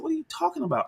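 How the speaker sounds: squeaky high, soft disbelieving question; mock bafflement. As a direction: pitch way up in feigned innocence. Speaker retells his own baffled reply.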